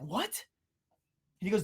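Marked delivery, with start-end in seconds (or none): 0.0-0.4 tiny, very soft questioning voice; stunned. Retold or imitated. Colleague stunned by boss's outburst, meekly quiet. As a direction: drop to a near-silent, rising question.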